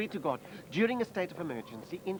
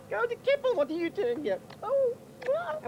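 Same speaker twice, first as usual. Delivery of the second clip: high squealing mock delight; mock surprise; 4 dB louder than usual. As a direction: squeal back her name, much higher. [name] mirrors surprised greeting.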